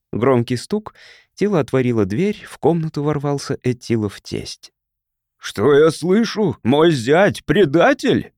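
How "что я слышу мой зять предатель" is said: slow, louder and higher indignant demand; an old father bursting in, furious; outraged old man. A narrator's reading. Father-in-law bursts in hearing of traitor son-in-law, outraged. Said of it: "Старик побагровел".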